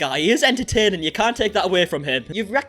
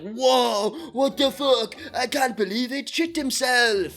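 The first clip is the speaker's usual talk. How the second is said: mock big reaction; 6 semitones higher, much slower than usual. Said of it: "give such a big reaction".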